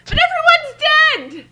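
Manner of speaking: mock wail. The group joking about the story's ending, mock wailing. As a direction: wail it like a melodramatic mourner. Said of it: "mock despair at ending".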